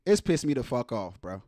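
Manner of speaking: low, subdued upset; hurt, pissed off. Real, not acted. Host admitting the story hurt him, low and upset. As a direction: let it sink, low and wounded. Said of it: "it hurt me".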